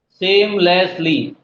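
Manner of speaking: सेमलेसली said slowly, each part drawn out; syllable by syllable. Shown as a demonstration. (धीरे-धीरे सेमलेसली)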